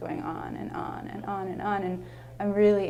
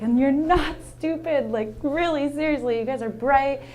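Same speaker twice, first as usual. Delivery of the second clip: earnest encouragement; 7 semitones higher, 6 dB louder than usual. Retold or imitated. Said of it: "I was like".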